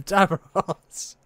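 high drawn-out call of the name; calling a muted friend. Retold or imitated. Friend mimicking calling a muted teammate.

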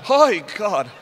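high loud gasp of shock; mock audience gasp. Imitating the audience's horror, loud high gasp. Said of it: "the whole audience went".

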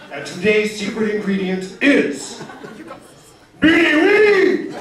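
booming game-show announcer, high and slow. Pretend. Imagined Iron Chef announcer to the crowd, hammed-up. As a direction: ham it up like the Iron Chef host revealing the ingredient. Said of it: "It would be like".

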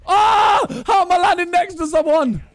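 high, loud panicked yelp; panicking gamer. Real, not acted. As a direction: freak out as you flee. Ambushed right after landing.

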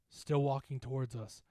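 usual speaking voice

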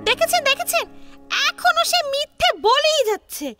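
high-pitched, heated insistence on ownership; indignant protest. Acted. Boy disputing a goat's ownership before the village headman, indignant. Quarrel over who owns goat.

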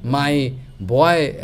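normal talking voice

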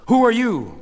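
sharp challenge. A narrator's reading. Doctor blocking two women at the ambulance, sharp challenge. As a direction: challenge strangers rushing forward.

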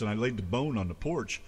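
normal talking voice

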